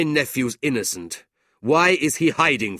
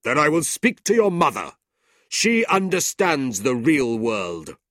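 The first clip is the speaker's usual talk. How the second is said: threatening uncle; 8 semitones higher, 8 dB louder than usual. A narrator's reading. Ralph threatens Mrs Nickleby, loud and cold. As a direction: threaten her coldly.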